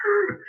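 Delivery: mock cry. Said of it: "was like".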